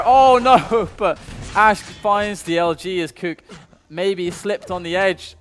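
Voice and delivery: loud, high, then slow, laughing disbelief; esports caster. Acted. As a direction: gasp at the surprise kill. Excited live play-by-play.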